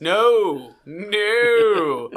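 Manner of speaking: loud, high double no; emphatic refusal. Real, not acted. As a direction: shut the idea down loudly. Refusing crazy plan.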